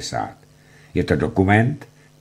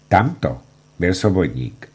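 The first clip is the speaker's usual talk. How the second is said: ordinary talk, nothing marked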